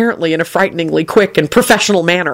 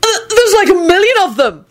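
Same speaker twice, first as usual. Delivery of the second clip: very high laughing amazement; 14 semitones higher than usual. A narrator's reading. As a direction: marvel mid-laugh. Laugh heard.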